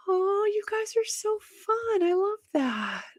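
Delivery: high, slow and soft gushing; delighted gush. Real, not acted. Host adoring her viewers, softly delighted. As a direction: melt over the audience.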